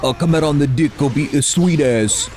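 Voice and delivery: bad New Zealand accent in a normal register; mock New Zealand accent, brought down from falsetto. Shown as a demonstration. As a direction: try the Kiwi accent without the squeak. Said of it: "I'm gonna try, like, lower".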